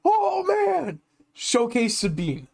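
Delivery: high imitated cry of amazement, slowing on the name; high mock shout, then drawn-out reveal; retold shout. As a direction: imitate a lucky player crowing over his find. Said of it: "he shouts, he's like".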